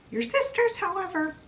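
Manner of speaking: very high, mock-sweet lead-in; acted sly turn. Mother turns on the sisters, high-pitched acted aside. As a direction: lift it high before the threat lands.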